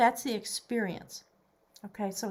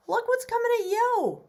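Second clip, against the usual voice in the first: excited; 13 semitones higher, 5 dB louder than usual. Real, not acted. Excited about a card reveal.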